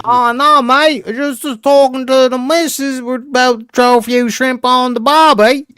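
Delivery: put-on Australian accent. Joking Aussie bloke bit.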